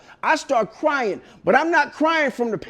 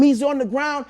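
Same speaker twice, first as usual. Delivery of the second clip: ordinary talk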